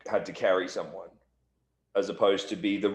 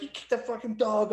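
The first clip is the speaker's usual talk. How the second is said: indignant accusation; 12 semitones higher than usual. Retold or imitated. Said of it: "and she's like".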